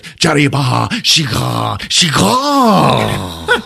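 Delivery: hammed-up alien yelling at a captive; yelling Drac. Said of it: "Drac starts fucking yelling at him".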